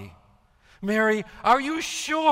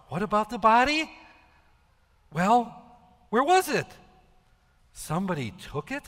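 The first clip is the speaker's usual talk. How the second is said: ordinary talk, nothing marked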